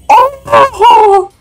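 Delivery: very high squealing amazement; giddy disbelief. Real, not acted. Collector stunned by his lucky pull, giddy squealing. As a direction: squeak it out, breaking into high laughter.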